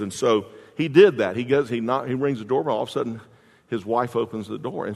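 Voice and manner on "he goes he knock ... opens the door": ordinary delivery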